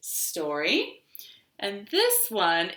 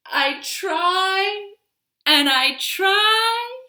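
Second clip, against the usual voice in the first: frustrated trying; 12 semitones higher, 6 dB louder, much slower than usual. Acted. Tree frustrated at colours.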